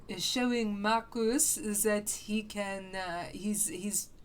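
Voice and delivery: lightly put-on mock French accent, halting and hesitant; bad French accent. Gamer rambling about androids in a bad mock French accent. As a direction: play a pretentious French critic in a deliberately bad French accent. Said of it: "It's not a good French accent".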